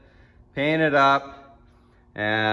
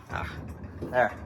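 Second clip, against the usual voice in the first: usual speaking voice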